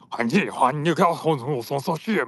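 a kidnapper babbles at her in mock Korean; mock foreign gibberish. Mock Korean gibberish. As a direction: fake Korean-sounding gibberish, lightly voiced. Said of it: "みたいなこと言われて".

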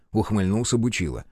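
plain reading voice

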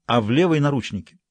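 ordinary delivery, nothing marked

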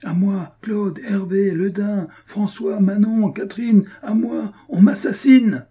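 panicked innkeeper's high yell, rising to a shout; yelling for help. A narrator's reading. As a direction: call out name after name as if being murdered. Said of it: "hurlait de toute la force de ses poumons".

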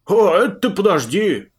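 loud gruff bark. Acted. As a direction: snap it back loudly. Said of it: "даже рявкнул на неё Пузан".

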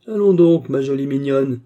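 high, loud mocking coaxing. A narrator's reading. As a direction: hustle her along with loud false affection. Vieille moqueuse entraîne la jeune fille. (cajolerie moqueuse, haute et forte)

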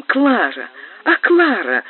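high, falling call of the name; waking someone. A narrator's reading. Anna waking Clara from a bad dream, calling her. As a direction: gently rouse a sleeper by name. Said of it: "будила Анна".

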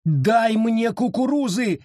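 desperate high shout. A narrator's reading. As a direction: cry out with all your heart. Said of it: "закричала она".

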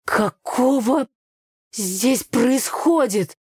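the brother, caught off guard, demands answers; indignant Igor. A narrator's reading. Brother catches his brother kissing her, slow indignant demand. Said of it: "возмущённый голос Игоря".

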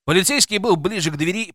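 plain reading voice

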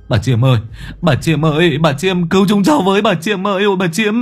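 desperate calling. A narrator's reading. Terrified couple pounding on the neighbour's door. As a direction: beg through the door in panic.

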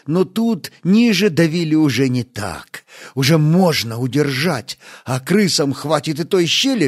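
ordinary delivery, nothing marked

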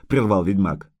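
usual speaking voice

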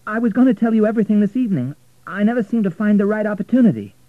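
high, loud, urgent excuse; flustered. A narrator's reading. Florentina explaining herself to Richard, flustered and urgent. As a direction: protest as if caught out. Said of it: "she stammered".

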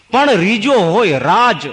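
loud, high, slow ringing line; verse declamation. Acted. Preacher reaches the king in his verse, declaiming grandly. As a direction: proclaim it with drawn-out emphasis.

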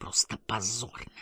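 low, whispered contempt; disgusted hiss. A narrator's reading. Madame shames her partner after the failed party, hissing. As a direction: hiss it under your breath in disgust.

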